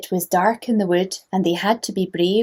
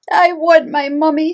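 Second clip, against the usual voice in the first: put-on high, loud, babyish wail; whimper-cry it like the tiniest owl; little owl wailing; 8 semitones higher, 6 dB louder than usual. Smallest owl Bill crying for his mummy, high and wailing.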